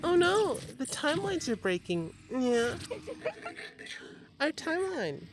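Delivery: high, theatrical panic; high mock panic. Real, not acted. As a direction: squeal that it's broken. Mock panic over broken game.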